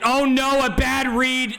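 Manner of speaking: high, urgent play-by-play cry; animated commentator's alarm; excited dismay. Real, not acted. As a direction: burst out at the blunder, then keep calling. Reacts live to a bad throw.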